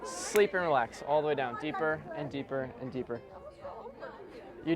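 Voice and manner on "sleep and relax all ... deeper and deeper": deep lowered drone sinking into deeper and deeper; hypnotic induction. Acted. Hypnotist sending volunteers under, low soft droning. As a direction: pull them down slowly, voice dropping lower and softer.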